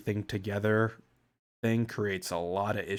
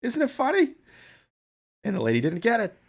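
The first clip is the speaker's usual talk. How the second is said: put-on much higher, louder Boston accent, giddy; Boston accent Bill Burr; 10 semitones higher, 6 dB louder than usual. Streamer acting out Bill Burr's muffin punchline in a Boston accent. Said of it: "he goes".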